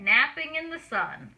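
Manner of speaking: plain reading voice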